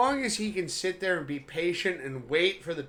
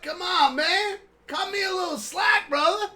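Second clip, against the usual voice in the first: high whiny plea; 11 semitones higher, 4 dB louder than usual. Acted. Pleading for slack, high pitched.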